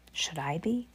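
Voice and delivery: scared hushed whisper. Acted. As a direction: barely breathe it. Whispered eerie narration.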